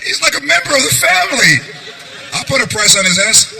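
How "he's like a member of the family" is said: high, tearful plea in imitation; mock crying. Imitating her crying over a vet bill, mock tearful. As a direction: mimic a weepy, higher-pitched protest. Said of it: "She was crying".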